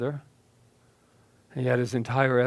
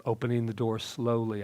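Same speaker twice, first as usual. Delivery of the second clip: plain reading voice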